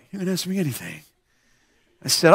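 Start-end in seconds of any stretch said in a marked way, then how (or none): 0.1-1.0 very soft, low mumble; imitating odd kid. Mohawked kid replies to the preacher, low mumbling imitation. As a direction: mumble it low, barely audible. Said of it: "Except he was like".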